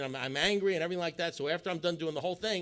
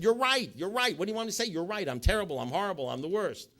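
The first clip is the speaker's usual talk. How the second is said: high, rattled-off capitulation; exaggerated surrender; 6 semitones higher, 4 dB louder than usual. Husband pleading guilty to his wife, in overdone surrender. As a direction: give in over and over, overdoing the agreement.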